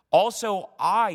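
"I" loud, high and drawn out; emphatic I. Retold or imitated. The Lord declaring his will to David, emphatic "I". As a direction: stretch and punch "I". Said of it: "it's even I, the Lord speaking".

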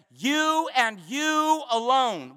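YOU and you ALONE, slow and deliberate; stretched, emphatic proclamation; emphatic declaration. Retold or imitated. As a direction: draw out "You and you alone" with weight. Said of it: "it's emphatic".

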